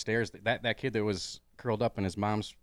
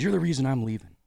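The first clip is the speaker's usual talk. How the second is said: imitated heckler, louder and higher; heckler's parting shot; 6 semitones higher, 6 dB louder than usual. Heckler leaving the open mic, loudly accusing. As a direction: call out the accusation while leaving. Departing heckler blames the comic.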